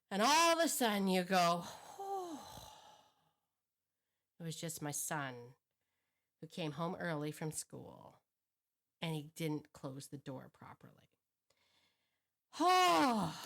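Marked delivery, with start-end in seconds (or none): 2.0-2.4 high, soft exhale of relief; relieved whew. Acted. Mother finds it is just her son, acted sigh of relief. 4.4-11.1 lower, softer, settling down; acted relief. Mother realizes it was only her son, acted quiet relief. Relieved parent after the scare. 12.6-13.3 big, loud, high sigh of relief; relieved sigh. Acted. Mother after the scare, hammed-up relieved sigh.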